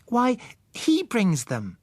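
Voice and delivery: put-on high, slow child voice, wide-eyed; surprised girl. Young Susan telling adults the obvious, slow high child voice. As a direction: spell it out like it's obvious. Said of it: "irritating expression of surprise".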